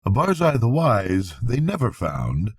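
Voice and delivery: usual speaking voice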